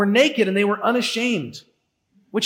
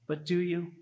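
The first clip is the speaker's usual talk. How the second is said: hushed tempting Satan; 3 semitones lower, 11 dB quieter than usual. Acted. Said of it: "Satan is the one who comes in and says".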